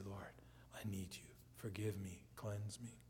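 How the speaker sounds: soft, low plea to God; hushed prayer. Acted. Convicted believer praying to the Lord, hushed prayer. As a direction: pray it quietly and low, in short separate pleas.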